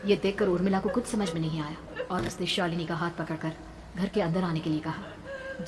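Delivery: plain reading voice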